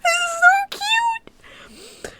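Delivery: very high, loud squeal of delight; gushing. Real, not acted. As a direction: melt over how cute it is. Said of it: "I'm so excited".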